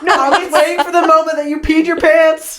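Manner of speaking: high, loud, laughing tease. Real, not acted. Friend teasing the storyteller about her accident, laughing. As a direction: say it through real laughter.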